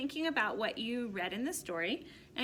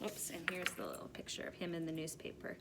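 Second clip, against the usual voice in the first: quiet aside; 3 semitones lower, 9 dB quieter than usual. Real, not acted. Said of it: "aside to class".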